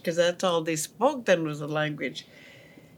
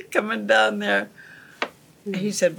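ordinary delivery

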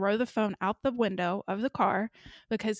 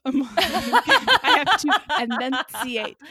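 laughing while talking; 5 semitones higher, 8 dB louder, slower than usual. Real, not acted. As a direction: laugh through it. Laughing about mishearing.

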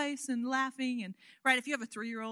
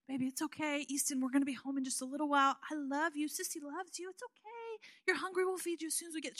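put-on higher, softer soothing voice for a crying baby; soothing big sister; 4 semitones higher, 4 dB quieter than usual. Sister soothing crying baby brother in the car, cooing. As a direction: coo to him gently like a big sister. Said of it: "she's just trying to soothe him".

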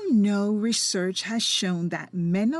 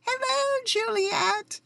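much higher, bubbly singsong greeting; cheerful Aunt Sonia; 13 semitones higher than usual. Retold or imitated. Quoting her cheerful aunt answering the phone, high and bubbly. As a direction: chirp it out like a sunny auntie. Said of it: "she really sounds like that cheerful character".

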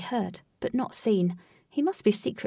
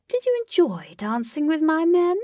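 louder, higher teasing question; taunting villain; 8 semitones higher, 5 dB louder than usual. A narrator's reading. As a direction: swoop up theatrically, toying with the captive. Villain Lutz taunts Katie.

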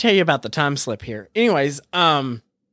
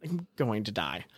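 defeated mutter; 7 semitones lower, 13 dB quieter than usual. Retold or imitated. Deflated end of the rant.

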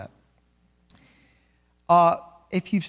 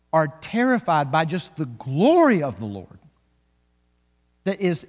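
building sermon emphasis; 3 dB louder, slower than usual. Acted. Preacher building intensity.

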